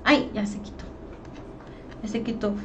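muttered to herself. Real, not acted. Chat vanished, muttering.

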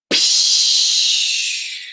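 unvoiced magic psh hiss. Shown as a demonstration. Host sending magic powers to children, unvoiced psh. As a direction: blow out a magic psh sound. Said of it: "And can you go".

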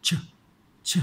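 ช as a short unvoiced "ch" puff; isolated consonant sound. Shown as a demonstration. Teacher showing what two letters sound like, isolated consonant sound. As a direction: release ช alone, no full vowel. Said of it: "สองตัวนี้ออกเสียง".

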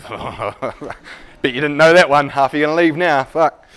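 loud laugh through the joke; laughing loudly. Real, not acted. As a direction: laugh it out at the audience.

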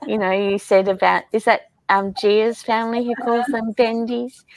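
normal talking voice

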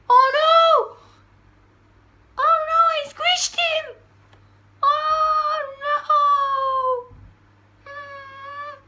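high, loud wail of horror; horrified shriek. Real, not acted. Having just squished the bug, a high horrified wail. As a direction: shriek as you realise you've crushed it.

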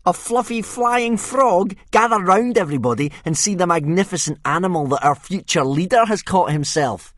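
high, loud jeering bully voice; a sneering Viking boy taunting Hiccup; mocking bully. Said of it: "said Snotlout".

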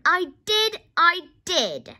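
high, loud protest; shouted. A narrator's reading. George insists to disbelieving Grandma, shouting. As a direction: insist at the top of your voice. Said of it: "George shouted".

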